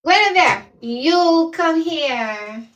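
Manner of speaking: high drawn-out call to a pet; calling the dog. Real, not acted. Host coaxes her dog Guinevere closer, slow high call. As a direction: coax the dog over, slow and sing-song.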